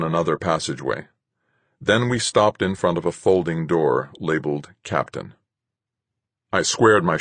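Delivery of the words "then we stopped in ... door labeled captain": ordinary delivery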